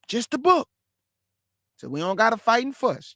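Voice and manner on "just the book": Just the book lifted high with a rising end; emphatic plea. Real, not acted. Preacher urging listeners to stick to scripture, high plea. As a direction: push Just the book up, pleading.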